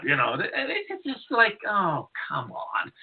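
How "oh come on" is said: ordinary talk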